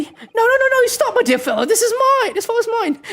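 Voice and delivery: high, loud frantic 'no, no, stop' as a flustered gentleman; cling to it like a prize you won't give up; high possessive protest. Acted. Character refusing to hand over his find, in a high frantic voice.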